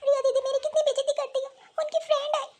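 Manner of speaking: put-on high, tearful little girl complaining; crying child. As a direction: whimper it like a hurt child. Child sobs out complaint to mother.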